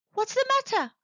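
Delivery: high worried little robot; anxious high question. Acted. Box asks, worried.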